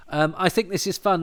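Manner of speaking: ordinary delivery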